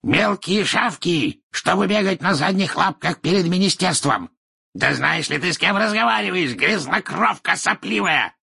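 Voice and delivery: high-pitched, scornful ranting; furious tirade. Acted. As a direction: hurl insults at an inferior. Furious tirade of insults at official.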